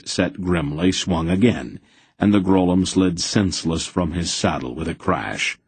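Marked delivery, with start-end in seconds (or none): none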